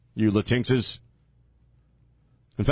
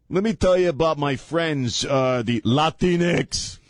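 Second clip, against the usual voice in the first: mock AOC accent. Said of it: "It's the only time A O C has an accent".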